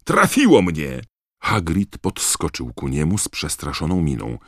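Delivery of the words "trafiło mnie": raised, louder acted cry; narrator's startled yell; pained yell. A narrator's reading. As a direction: cry out as if just hit. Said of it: "wrzasnął Dean Thomas".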